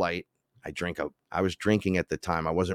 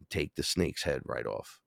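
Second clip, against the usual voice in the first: lower, softer, slow menace; 5 semitones lower, 6 dB quieter than usual. Retold or imitated. Ra gives advice, low and slow. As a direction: say it low and deadly. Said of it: "he goes".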